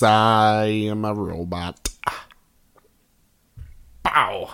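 slow low toy robot voice. Shown as a demonstration. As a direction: speak like a little toy robot. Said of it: "I can do a robot voice".

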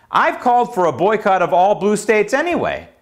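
ordinary talk

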